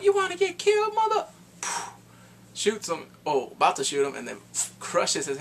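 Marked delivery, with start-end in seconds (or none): 0.0-1.3 high, loud tough-guy threat; mock threat. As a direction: snarl it up high and loud like a gunman. Acted threat from the story.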